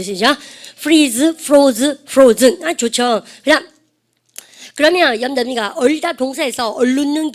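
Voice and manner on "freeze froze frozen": loud, separated recitation; drilling verb forms. Shown as a demonstration. Teacher leading students through the three verb forms, loud drill. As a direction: call out each form firmly for repetition. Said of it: "일단 삼 단면 해볼까?".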